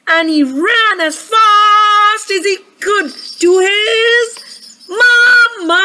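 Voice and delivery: high, drawn-out rush to the climax; excited storytelling. A narrator's reading. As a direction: build to a loud, held "mama". Teddy rushes to his mother.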